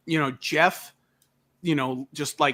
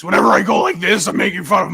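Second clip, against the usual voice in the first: put-on loud voice teasing a co-host; hammed-up impression of your buddy; mocking Mikey; 4 semitones higher, 7 dB louder, faster than usual. Shown as a demonstration. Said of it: "my making fun of Mikey voice".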